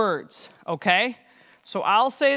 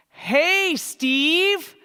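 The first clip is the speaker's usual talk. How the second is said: mock shout; 7 semitones higher than usual. Shown as a demonstration. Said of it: "Should we shout".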